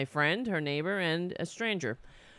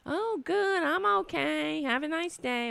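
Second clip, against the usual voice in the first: high-pitched imitation of a sweet elderly neighbor; old lady; 10 semitones higher than usual. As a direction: chirp back like a little old lady. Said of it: "the old lady would be like".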